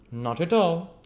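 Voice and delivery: high, loud dismissive retort; pompous gentleman. Acted. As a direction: brush the offer off sharply.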